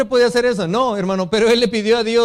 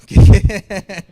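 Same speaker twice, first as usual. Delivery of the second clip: laughing, loud, unbelieving Qué; laughing disbelief; 11 dB louder than usual. Retold or imitated. Retells surprise when asked to lead choir.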